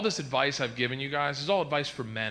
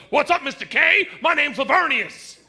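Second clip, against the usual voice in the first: much higher, louder swaggering greeting; boyfriend character; 9 semitones higher, 6 dB louder than usual. Retold or imitated. Imagined boyfriend greeting girlfriend's dad, loud swagger. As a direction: strut in greeting the father. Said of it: "he walks in and he goes".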